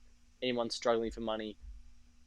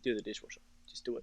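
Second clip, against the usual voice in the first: quiet urging; 8 dB quieter, faster than usual. Acted.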